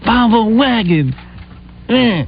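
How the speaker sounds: slow, high, dismissive mimicry; mock the wife's disgust, drawn out and high; high-pitched dismissive wife voice; imitating complaining wife. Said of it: "she'll start yelling at me".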